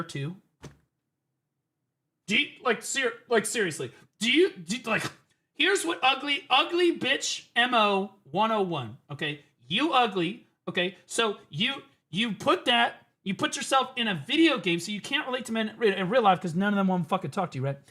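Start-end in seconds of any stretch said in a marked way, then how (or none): none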